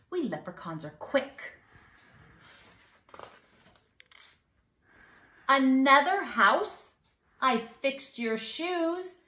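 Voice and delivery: put-on sing-song rhyme for kids; mischievous leprechaun. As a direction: read it like a cheeky little leprechaun bragging. Leprechaun rhyme read aloud.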